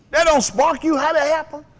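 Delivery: high, loud, challenging the crowd; fiery preaching. Real, not acted. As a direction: hit them with it, fired up.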